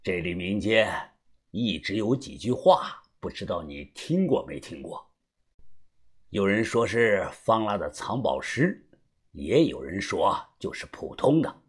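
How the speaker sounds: low, subdued storyteller's character voice; understated older acquaintance, low and soft; indifferent, calm. A narrator's reading. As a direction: speak evenly in a low, unhurried undertone. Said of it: "他看着我淡淡的说道".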